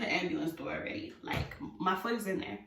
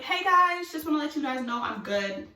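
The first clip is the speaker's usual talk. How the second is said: high, loud, chirpy greeting easing down; breezy dismissal; 7 semitones higher, 4 dB louder than usual. Retold or imitated. Waving off the ambulance people, breezy dismissal. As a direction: call out cheerily as if all is fine.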